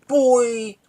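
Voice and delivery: high, loud, stretched holler; drawn-out mock-boastful yell; shouted catchphrase. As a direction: crow it out like a taunt. Repeats his shouted catchphrase.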